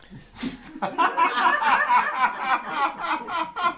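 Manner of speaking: a long high burst of real laughter; high giggling laugh. Real, not acted. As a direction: break into real laughter at the joke. Speaker laughs at own joke.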